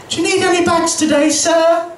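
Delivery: high, sing-song shop assistant voice; chirpy till voice asking about bags; high polite cashier. Retold or imitated. Cashier asks the customer at the till, high and sing-song.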